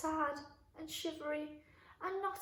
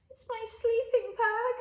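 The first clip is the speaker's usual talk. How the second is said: high, louder tearful fox voice; a sob-tinged complaint; crying fox; 10 semitones higher, 8 dB louder than usual. A narrator's reading. Fox crying to George by the tent, tearful and high. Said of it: "said the fox".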